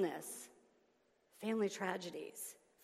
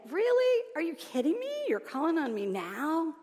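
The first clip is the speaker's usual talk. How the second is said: high, loud mock disbelief; incredulous God; 8 semitones higher, 6 dB louder than usual. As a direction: act indignant and incredulous, starting high and easing down. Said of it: "I can just see God up there saying".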